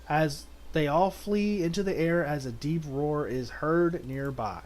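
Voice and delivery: ordinary delivery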